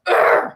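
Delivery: frustrated groan, re-enacted; loud grumble. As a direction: grumble it out like an annoyed moviegoer. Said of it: "I grumbled very loudly".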